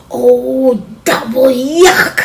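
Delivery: loud, slow drawn-out yuck; disgusted. Acted. Sophia reacting to smelly fish, loud and disgusted. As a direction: groan it like something stinks. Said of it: "Sophia said".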